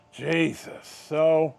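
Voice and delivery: usual speaking voice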